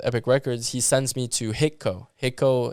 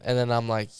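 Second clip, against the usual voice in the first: ordinary talk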